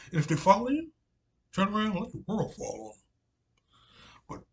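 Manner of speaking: put-on high, loud South Carolina accent on the warning; loud South Carolina accent, retelling the prophet; Southern Carolina accent. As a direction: call it out in a booming old South Carolina voice. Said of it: "in that old South, Southern, uh, Carolina accent".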